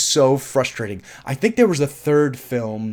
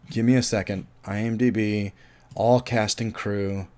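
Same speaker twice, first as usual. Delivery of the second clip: usual speaking voice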